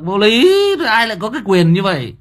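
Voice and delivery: high, loud cry of disbelief; indignant outburst. Real, not acted. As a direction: burst out loudly at the absurdity. Indignant at banning others' likes. (kêu to, giọng cao, phẫn nộ)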